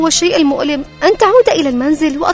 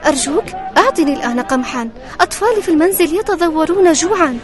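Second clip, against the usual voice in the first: higher, pleading poor widow; 4 dB louder than usual. Acted. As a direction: ask humbly like a poor widow. Widow begs for patience.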